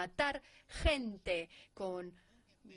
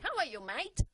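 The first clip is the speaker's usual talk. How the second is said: a raised, bright Australian "How are you, mate?"; Australian accent; 6 semitones higher than usual. Shown as a demonstration. Demoing an Australian accent for the class. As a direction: greet a mate in an Aussie accent. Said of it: "Es el acento australiano".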